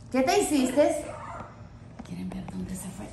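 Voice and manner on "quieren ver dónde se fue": hushed whisper to viewers. Real, not acted. Host whispering to viewers about where the pet went. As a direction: whisper so the pet won't hear.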